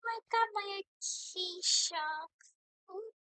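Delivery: high, slow sing-song; sing-song glee. Real, not acted. Streamer crooning happily about her Acacia, slow sing-song. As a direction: croon the words slowly with glee. Gloating over the Acacia again.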